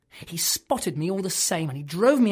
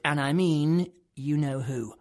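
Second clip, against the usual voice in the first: normal talking voice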